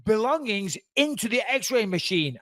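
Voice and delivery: high, slow officious instruction; over-the-top guard. Retold or imitated. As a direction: imitate an overbearing security guard spelling out an order. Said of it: "a little bit over the top".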